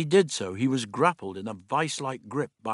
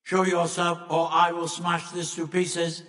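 higher, louder alien monotone; monoid threat; 7 semitones higher, 3 dB louder than usual. A narrator's reading. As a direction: threaten on one unwavering note. Monoid threatening to smash the vase.

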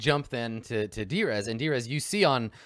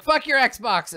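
high, very loud retort; kid shouting back; 14 semitones higher, 10 dB louder, slower than usual. Acted. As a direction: yell back at your sibling. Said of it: "the other one's like".

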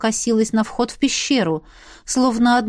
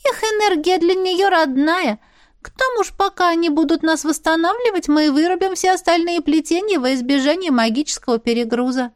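much higher, light fairy voice; high little sprite voice; 8 semitones higher than usual. Acted. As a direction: voice a tiny magical creature. Реплика Искры. (высокий голосок феи)

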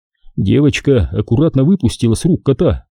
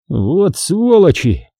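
slow, higher angry curse; swearing; 6 semitones higher, much slower than usual. Acted. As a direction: spit out the curse. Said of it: "начал было Николай".

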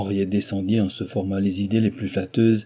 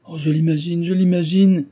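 higher and louder, rising with flustered excitement; blushing exclamation; 9 semitones higher, 5 dB louder than usual. A narrator's reading. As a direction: cry it out, flustered and eager. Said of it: "s'écria-t-elle en rougissant".

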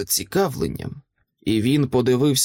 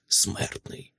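menacing whisper; 7 dB quieter than usual. A narrator's reading. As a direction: whisper the last word like a threat. Menacing threat to mortal.

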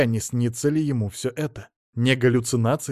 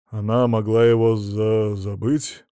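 slow, halting invention; stammered excuse; 3 semitones lower, slower than usual. A narrator's reading. Son-in-law makes up an excuse, slow stammer. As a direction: stall halfway through the word.

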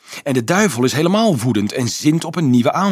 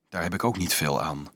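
low, soft and downcast, half to himself; dejected thought; 5 semitones lower, 6 dB quieter than usual. A narrator's reading. Dolf thinks dejectedly after the monk's words, soft and downcast. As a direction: let the hope drain out of it. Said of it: "dacht Dolf mismoedig".

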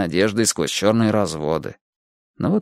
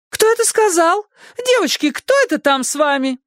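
put-on high shrill-toned old lady demanding; demanding neighbour. A narrator's reading. Neighbour demands who is with the girls, high demanding voice. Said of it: "neighbour demands answers".

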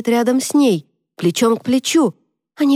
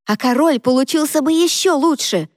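прорычала; 7 semitones higher than usual. Acted. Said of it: "прорычала Шейн".

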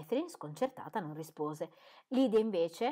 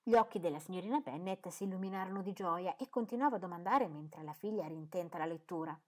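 ordinary talk, nothing marked

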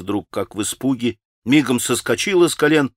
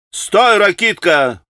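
slow, higher, loud stop; sharp cry; 9 semitones higher, 5 dB louder, slower than usual. A narrator's reading. Grushenka jumping up to stop Rakitin, loud sharp cry. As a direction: cut him off hard. Вскочила вдруг Грушенька.

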